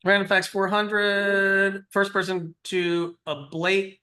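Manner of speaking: high, drawn-out announcer delivery; game-show host reading the board; quiz-host read-out. Pretend. Reads out quiz category and clue.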